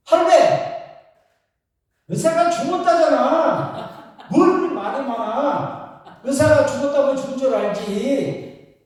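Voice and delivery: loud, high-pitched mock scolding; comic shouted grandma telling-off. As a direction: tell him off like an exasperated old wife. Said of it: "할머니가 야단을 치더랍니다".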